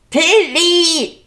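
loud yell, pitched high; yelling a name. Acted. Child yells for Tilly to bring snacks, loud yell. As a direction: bellow his name across the house. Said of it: "was brüllst du hier so rum".

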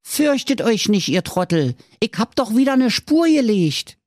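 put-on high, cheeky kid voice with Berlin twang; cheeky little boy. A narrator's reading. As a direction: play the bold little brat. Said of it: "aber sprach zu seinen Brüdern".

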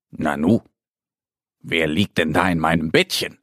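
a lightly voiced bear cub calling out; little bear voice. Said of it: "ruft der kleine Bär". (Mo wundert sich über Annie in seinem Bett, tiefere Bärenstimme)

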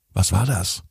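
plain reading voice

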